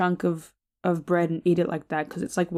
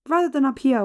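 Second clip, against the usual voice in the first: high voice; 6 semitones higher, faster than usual. Shown as a demonstration. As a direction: voice pushed up high. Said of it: "that was a horrible attempt".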